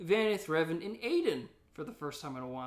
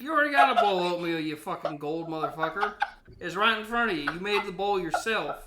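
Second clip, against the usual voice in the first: put-on angry old prospector; 3 semitones higher, 8 dB louder than usual. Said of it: "and then there's Old Prospector".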